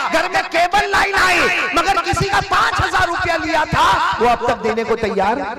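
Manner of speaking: high, loud preaching at full force; rising preaching rebuke. Real, not acted. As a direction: hammer the point home. Preacher scolding the crowd. (ऊँची आवाज़ में डाँटते हुए उपदेश)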